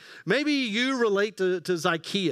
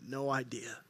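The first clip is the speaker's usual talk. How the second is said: thought to oneself; 7 semitones lower, 6 dB quieter than usual. Retold or imitated. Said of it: "and you're thinking".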